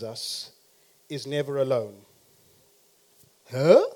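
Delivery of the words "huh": loud mock-baffled rising question; puzzled reaction. Preacher playing the congregation's predicted response, mock bafflement. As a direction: imitate a confused listener, pitch swooping up. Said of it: "you're gonna go".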